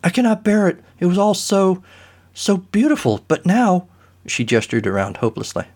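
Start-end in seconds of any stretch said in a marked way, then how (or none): 0.0-3.9 distraught actress. Acted. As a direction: wail over the ruined costumes.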